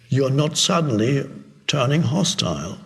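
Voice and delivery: ordinary talk, nothing marked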